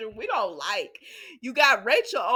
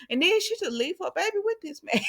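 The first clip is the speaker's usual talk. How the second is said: high-pitched disbelief; incredulous; 10 semitones higher than usual. Real, not acted. Host recaps a mother leaving her baby, incredulous. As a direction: raise the voice into amused incredulity.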